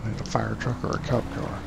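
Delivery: low, soft murmur; quiet self-talk. Real, not acted. Player alone plotting next vehicle theft, quiet self-talk. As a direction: think aloud under your breath. Talks to himself while hiding.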